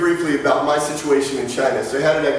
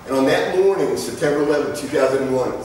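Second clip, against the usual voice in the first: normal talking voice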